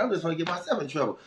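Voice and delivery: normal talking voice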